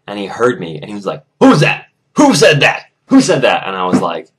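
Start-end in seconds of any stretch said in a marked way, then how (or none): 1.4-3.7 high, loud repeated challenge; angry demand. Retold or imitated. Coach who overheard backtalk, shouting angrily. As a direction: shout to root out the culprit.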